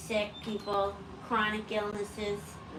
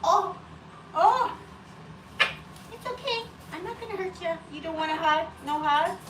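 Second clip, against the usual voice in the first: soothing pet talk; 9 semitones higher than usual. Real, not acted. Owner promising the bird no hurt, high soothing baby talk. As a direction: reassure a small pet in a high coo.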